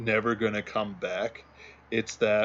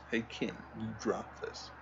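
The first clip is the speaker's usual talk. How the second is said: much softer, murmured meme line; quiet quote; 10 dB quieter than usual. Retold or imitated. Muttering the meme while searching, soft murmur. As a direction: say it under your breath. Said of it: "it's that".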